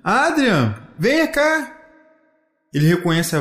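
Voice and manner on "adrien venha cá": high beckoning call. A narrator's reading. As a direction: summon someone from a distance. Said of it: "ouve um som dizendo".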